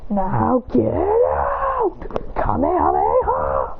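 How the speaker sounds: very slow, drawn-out strangled scream; strained battle cry. Acted. He rips the chains off, strained battle cry. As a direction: stretch each word like an anime attack call.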